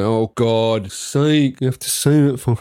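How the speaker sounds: slow, mock exasperated drawl; imitating an exasperated listener. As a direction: drag it out like a fed-up listener. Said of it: "She's listening now going".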